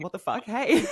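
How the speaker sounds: a touch of startled laugh, high; mock bewildered hey; imitating a puzzled stranger. Woman's startled reply, retold.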